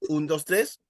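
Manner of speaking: ordinary talk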